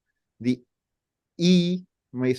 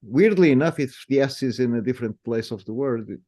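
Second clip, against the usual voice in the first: ordinary talk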